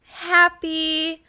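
high, hesitant question with long pauses; imitating Sam. Podcaster acting out Sam's awkward greeting, tentative. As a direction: draw it out slowly, rising at the end. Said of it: "Sam just turns around and goes".

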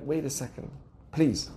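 soft, low plea for patience; quiet, polite interjection; restrained request to wait. Acted. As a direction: gently hold them off, low and quiet. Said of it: "And you're like".

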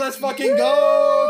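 high, slow, loud hype yell; hype shout. Real, not acted. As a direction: shout it like an air horn. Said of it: "[name]'s air horns celebration".

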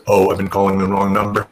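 low, even deadpan; Tommy Dreamer. Retold or imitated. Boss realizing he misdialed, monotone. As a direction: imitate someone noting a mistake in a monotone. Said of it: "This super monotone voice".

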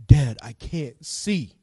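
son's plea retold slowly, without the shout; slow, restrained call to dad. Same retold line, said lower.

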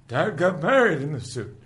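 high, loud character quote; mock exclaimed announcement. Acting out the episode's character.

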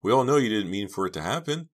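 pleading, keyed-up insistence; anxious insistence. A narrator's reading. Stammered reassurance to the killer.

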